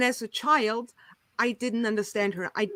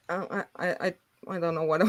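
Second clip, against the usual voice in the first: usual speaking voice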